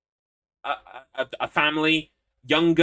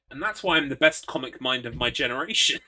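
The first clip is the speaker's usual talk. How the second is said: ordinary talk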